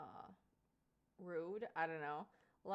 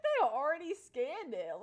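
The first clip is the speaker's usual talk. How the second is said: laughing retold quote; 6 semitones higher, 10 dB louder than usual. Quote repeated.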